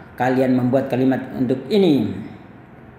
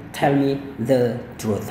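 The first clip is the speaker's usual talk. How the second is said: "Tell me the truth" drawn out slowly, "Tell" stressed; slow model sentence; 3 semitones lower, 3 dB quieter, much slower than usual. Shown as a demonstration. Teacher modelling an example sentence, slowly. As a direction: say it slowly for learners. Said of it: "Once again".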